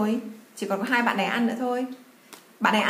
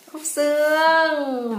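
excited; 5 semitones higher, 3 dB louder, much slower than usual. Acted. Revealing the answer to child.

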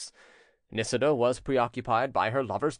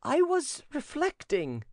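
high, slow self-justifying servant; bold protest; slower than usual. A narrator's reading. Servant excusing himself to the prince, bold protest. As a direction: draw it out high, defending yourself. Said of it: "cried the servant boldly".

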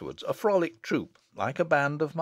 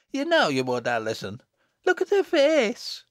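put-on shrieky teasing child, rising to the end; screaming glee; 12 semitones higher, 6 dB louder than usual. Little girl teasing blushing sister, building to shriek. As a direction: build to a loud, high mocking shriek. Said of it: "with a screaming laugh".

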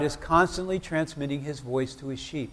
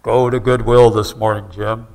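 lower, loud booming God voice; big booming voice; 6 semitones lower, 11 dB louder than usual. Retold or imitated. Preacher imitating God as Charlton Heston, booming. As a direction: boom it out like an epic movie God. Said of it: "Charlton Heston with the big booming voice".

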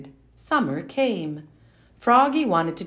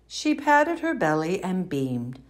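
ordinary delivery

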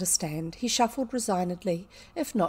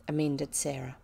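ordinary delivery

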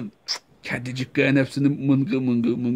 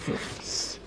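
whispering while hiding; 12 dB quieter than usual. Acted.

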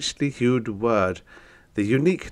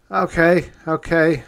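high, loud urgent plea; loud pleading; 9 semitones higher, 7 dB louder than usual. Acted. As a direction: beg them to stop, loudly. Narrator pleads in steam.

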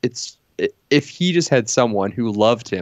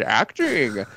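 Laurence Olivier impression; 3 semitones higher than usual. Quoting a great actor's famous line, hammed-up and drawn out. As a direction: stretch the word out like a hammy stage actor. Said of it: "that's exactly how Laurence Olivier sounded".

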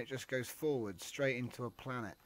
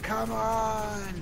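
frustrated shout; 9 semitones higher, 8 dB louder than usual. Real, not acted. Frustrated while being attacked.

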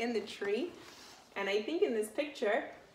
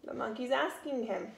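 plain reading voice

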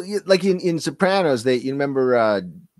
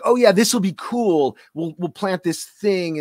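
slow, higher, mock eager; mock producers; 6 semitones higher, slower than usual. Mocking the producers' plan, slow and higher. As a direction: play the filmmakers, pleased with themselves. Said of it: "they were like".

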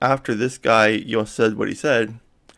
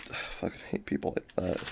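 soft unvoiced muttered hate; whispered aside; 4 semitones lower, 13 dB quieter than usual. Real, not acted. Vlogger vents his hatred of such people, whispered. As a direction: drop to a whisper to vent. Venting hatred under breath.

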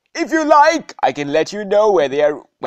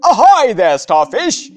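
calling out; 4 semitones higher, 6 dB louder than usual. Acted. Game character greets starfish.

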